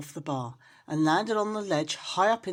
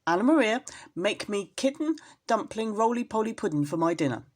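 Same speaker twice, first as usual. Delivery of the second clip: louder bossy rat demand; old rat ordering; 4 semitones higher, 3 dB louder than usual. Acted. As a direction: order his dinner from his wife. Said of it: "said the old man rat".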